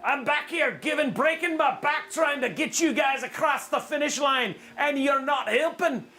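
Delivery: high, hammed-up Scottish brogue; exaggerated Scottish accent, building to a shout. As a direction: strain like an overworked Scottish ship's engineer. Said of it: "Maybe he's Scottish".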